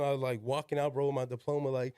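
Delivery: ordinary delivery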